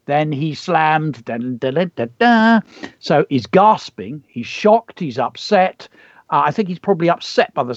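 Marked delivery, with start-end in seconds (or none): 1.2-2.6 mock suspense "dun, dun, dun"; dramatic sting. Teacher voices a suspense sting after "he slammed", mock dramatic.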